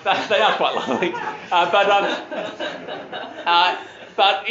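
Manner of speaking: can't hold back a chuckle mid-sentence; real laughter. Real, not acted. Preacher teasing about his wife's feet, laughing.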